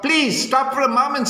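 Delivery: high, begging call after her; pleading prince. A narrator's reading. Prince calls after fleeing Cinderella, high and pleading. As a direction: beg her to stop, pitched high. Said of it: "says the prince".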